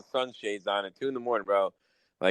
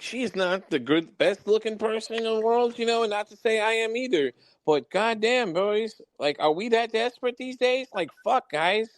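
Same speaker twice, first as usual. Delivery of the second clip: higher, slowed, disbelieving outburst at the guys; exasperated rant; 11 semitones higher than usual. Retold or imitated. To the guys hooking up with her, exasperated higher rant.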